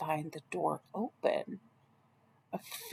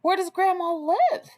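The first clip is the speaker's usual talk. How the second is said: high, loud, eager wolf question; the wolf fishing for an answer; sly wolf; 11 semitones higher, 4 dB louder than usual. Acted. Wolf probes Red for information.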